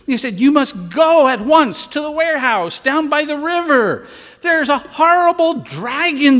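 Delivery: high, loud urgent alarm; excited warning. Acted. Cantor tricking the children with dragon tale, urgent alarm. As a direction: raise the alarm to send the kids off.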